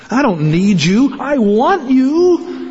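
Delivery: slow, with I and you leaned on; loving declaration, slowed right down; slow emphasis. Retold or imitated. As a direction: draw it out, pressing each word. Voicing the healed man.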